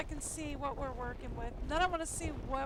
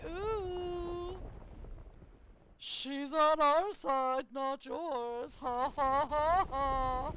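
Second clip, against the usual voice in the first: louder, slow, put-on gloating voice ending in fake laughter; mock villain gloating; 6 dB louder, much slower than usual. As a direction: ham up a cackling bad guy. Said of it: "was like".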